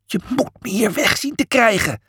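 high, frightened plea; trembling with fear. A narrator's reading. Julius begging Miles to get him away, frightened. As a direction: beg in a high, scared voice. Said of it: "Hij trilde als een blaadje".

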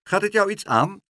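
high, loud indignant challenge; cold rebuke. A narrator's reading. Mevrouw Helderder rebuffs the boy's protest, cold rebuke. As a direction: put him sharply in his place. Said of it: "met haar koude ogen aan".

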